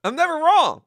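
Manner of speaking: loud, high burst of self-certainty; emphatic boast. Real, not acted. Streamer insisting to viewers he is right, loud and emphatic. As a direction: shout it as an indignant boast.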